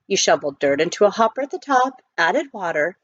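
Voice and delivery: ordinary delivery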